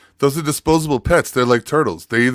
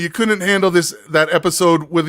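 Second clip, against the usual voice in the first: ordinary talk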